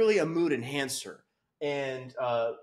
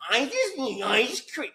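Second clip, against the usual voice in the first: high-pitched, put-on needy voice; exaggerated mock craving, pitched way up; mocking dieter voice; 8 semitones higher than usual. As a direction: ridicule a whining dieter. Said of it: "in a tone that really reflects what the fuck I hear".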